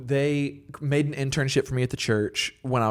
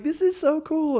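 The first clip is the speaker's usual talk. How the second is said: much higher gushing voice; mock fan comment; slower than usual. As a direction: squeak it like an overexcited fan. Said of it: "Just being like".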